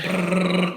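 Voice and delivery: long lip-trill engine noise; imitated sputtering motor. Mocking a gassy classmate, imitated motor sputter. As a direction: imitate a motor that won't catch. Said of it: "problem starting his fucking motor".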